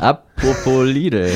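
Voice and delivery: very slow, stretched "apropos Lidl"; slow drawn-out echo. Pretend. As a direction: drag the words out slowly.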